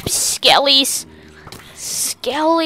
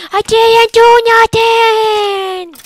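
high, slow, shouted protest; drawn-out shout; 10 semitones higher, 5 dB louder, slower than usual. Pretend. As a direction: stretch it into an outraged yell. Protesting innocence again, louder.

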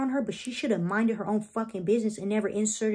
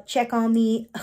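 ordinary talk, nothing marked

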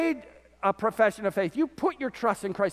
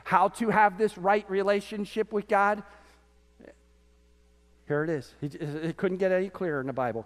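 normal talking voice

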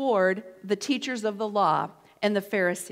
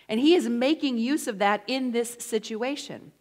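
usual speaking voice

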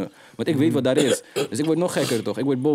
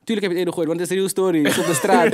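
laughing; 7 semitones higher, 5 dB louder, faster than usual. Real, not acted. Laughing, telling it's real street story.

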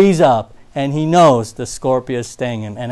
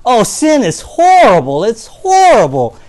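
higher and louder, hammed-up dismay; mock lament; 6 semitones higher, 7 dB louder than usual. Preacher mimicking churchgoers decrying sin, mock lament. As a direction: overdo the hand-wringing. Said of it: "Many people say".

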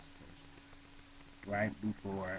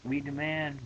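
loud, higher yelled demand; yelling; 7 semitones higher, 9 dB louder, slower than usual. Acted. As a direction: yell the demand. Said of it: "yelled Broomfondle".

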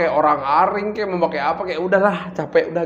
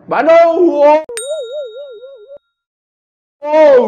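excited shout; 8 dB louder, much slower than usual. Real, not acted. Cheering for his home city.

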